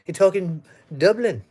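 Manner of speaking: put-on playful try at an Irish accent, not fully there. Answering an Irish viewer from Dublin, mock Irish accent. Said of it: "I try to do my Irish accent".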